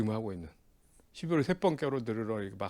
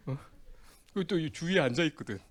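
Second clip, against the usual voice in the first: ordinary talk, nothing marked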